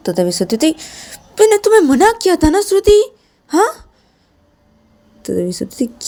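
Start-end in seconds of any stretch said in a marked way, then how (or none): 1.4-3.7 much higher, louder rebuke; sharp rebuke. Acted. Shreya reminds Shruti she forbade it, higher and louder. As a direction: snap back at her disobedience.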